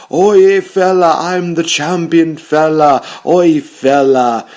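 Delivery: loud, high, slow put-on Irish brogue as Sheamus, comically Australian-sounding; mock Sheamus accent. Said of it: "Why am I making him sound like Australian?".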